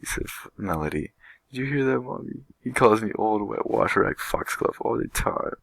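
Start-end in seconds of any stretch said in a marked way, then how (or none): 1.5-5.6 high, louder whiny tattling; tearful whining. Acted. Melody to her mother, tattling on Harvard through tears. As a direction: whine to Mommy on the edge of tears. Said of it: "She began to cry again".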